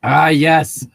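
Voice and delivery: normal talking voice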